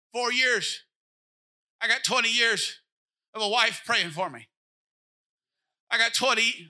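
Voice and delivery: loud, driving preacher cadence; full-force preaching. Acted. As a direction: preach it hard to the congregation.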